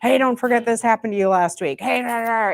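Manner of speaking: busy mind yammering away; nagging inner voice. Acted. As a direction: pester quickly, tumbling into nonsense syllables. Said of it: "that talking that shows up in your head".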